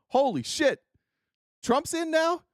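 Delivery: high, giggly disbelief; mock astonishment. China gloating over Trump, high mock astonishment.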